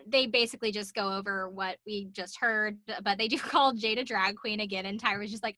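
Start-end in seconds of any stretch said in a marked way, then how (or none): none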